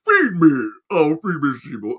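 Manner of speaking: lightly voiced hungry plant begging Seymour. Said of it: "I did the voice of the plant".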